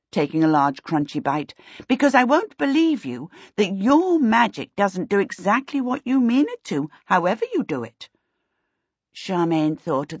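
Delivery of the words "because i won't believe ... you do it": higher-pitched, swooping emphatic witch; dismissive witch. A narrator's reading. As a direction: lay down the verdict, stressing key words. Voices the witch.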